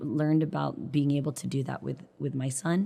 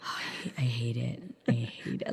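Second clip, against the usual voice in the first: half whisper; 3 semitones lower, 5 dB quieter than usual. Real, not acted. Mother groans about son's new hair.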